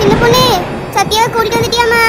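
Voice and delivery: loud shout across the water; calling out. Acted. Mother shouting across the flood to her daughter and friend, loud. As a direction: shout to them from afar.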